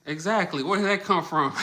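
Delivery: loud and high, thrilled surprise; loud high exclaim, laughing at the chat; excited. Real, not acted. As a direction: jump on the comment with delight. Reacting to a chat comment.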